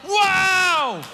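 thrilled believer reading good news; loud amazed wow. Pretend. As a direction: blast it out like headline news from heaven. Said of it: "and you read it and you're like".

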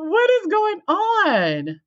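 bewildered facilitator. Retold or imitated. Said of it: "And the facilitator like".

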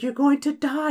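very high mock-eerie threat; menacing otherworldly voice. Game master voicing the amulet to two guys, high eerie threat. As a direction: intone doom in a high put-on voice.

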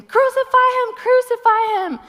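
high, loud shouted chant; shouting. Retold or imitated. Preacher retelling the leaders' cry to crucify, shouting. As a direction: retell the mob's scream. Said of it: "the religious leaders come".

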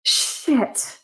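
acted fluster, pitch climbing on the curses; getting frazzled. Player's character searching her bag for a wallet, getting frazzled. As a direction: sigh and fret, increasingly frazzled. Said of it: "start getting frazzled or whatever".

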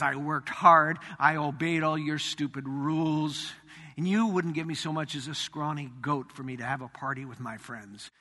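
low, quiet, bitter grumble; bitter elder son sneering at his father; contemptuous. Retold or imitated. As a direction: let the resentment drip off every word. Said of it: "Can you hear the contempt on his voice".

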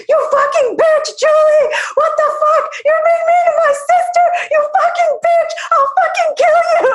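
furious brother. Retold or imitated. Brother defending his sister, screaming high furious curses. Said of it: "replicate exactly how my brother did this".

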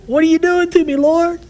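high, loud mock cry to the Lord; exasperated plea. Preacher retelling his plea to God, high and exasperated. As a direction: throw up your hands, half laughing.

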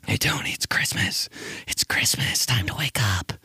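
soft whisper in the sister's ear; whispering to a sleeping sister. Retold or imitated. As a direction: coax her awake in a hush. Waking sister on Christmas.

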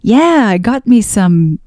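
playfully mock the Canadian accent with a big "eh". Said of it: "what I consider to be a Canadian accent is more of".

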